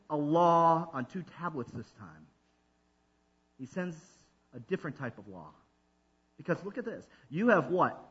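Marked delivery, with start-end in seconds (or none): none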